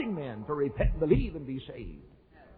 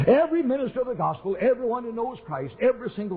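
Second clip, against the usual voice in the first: rising preaching shout; 10 semitones higher than usual. Real, not acted. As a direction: lift it into full pulpit fervor. Preacher climaxing point to congregation.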